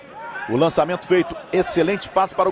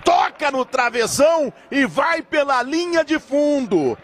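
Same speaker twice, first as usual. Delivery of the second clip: excited shout; 9 semitones higher than usual. Real, not acted. Shot hits crossbar.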